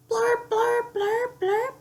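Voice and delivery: high honking horn sound; horn blurp. A narrator's reading. Reading to a child, a high horn blurp. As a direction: blare it like a little horn. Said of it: "He can go like a horn".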